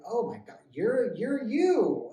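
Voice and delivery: drawn-out, loud, high exclamation of surprise; slow, loud gasp of recognition; surprised recognition. Retold or imitated. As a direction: stretch it out in shock at who she is. Recognizing drunk woman, surprised exclamation.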